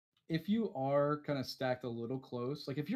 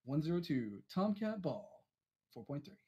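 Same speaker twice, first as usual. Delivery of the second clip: cool fighter pilot voice; 3 semitones higher than usual. Shown as a demonstration. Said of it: "That's your, your cool fighter pilot voice".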